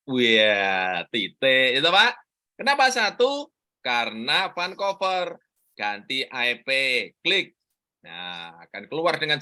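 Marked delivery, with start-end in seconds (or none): none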